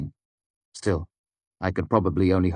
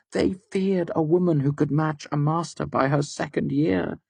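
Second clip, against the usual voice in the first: higher, mocking woman's voice; taunting Devi; 12 semitones higher than usual. A narrator's reading. Devi taunting the straining narrator. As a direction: gloat over his efforts.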